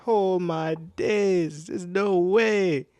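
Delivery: retold long-drawn lament; dismayed disbelief. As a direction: stretch each phrase in exaggerated disbelief. Retells dismay at own voice.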